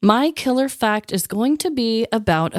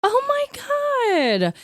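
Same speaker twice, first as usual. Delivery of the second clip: amazed; 13 semitones higher, much slower than usual. Real, not acted. Reacting to strengthened relationship.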